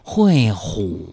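sloth. Pretend. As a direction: crawl through every syllable. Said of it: "用树懒的方式来说话".

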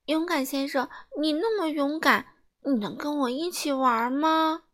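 put-on high crying girl's voice, sob on the first sound; mild tearful asking. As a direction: plead through light tears. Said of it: "她哭着说".

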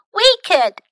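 put-on high, loud girlish reply; eager volunteering. Millie and Molly volunteer to teach, high and eager.

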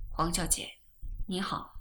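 soft, low, dazed greeting; softly. A narrator's reading. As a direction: greet softly, as if waking from a dream. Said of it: "轻轻说".

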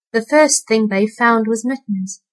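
plain reading voice

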